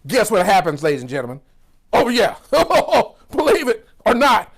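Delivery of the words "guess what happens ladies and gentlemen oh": normal talking voice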